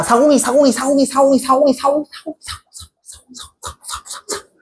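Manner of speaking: rapid chanted repeats fading into a whisper; endless repetition. Shown as a demonstration. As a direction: repeat it over and over until the voice vanishes. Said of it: "반복하는 거 아이가?". (계속 반복하며 속삭임으로 사라지게)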